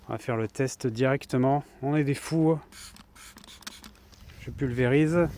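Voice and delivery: put-on un léger accent du Midi, plus fort; accent du sud. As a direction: joue le Marseillais qui se lance, avec l'accent du sud. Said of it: "je prends l'accent du sud". (accent du sud à peine appuyé, lancé avec entrain)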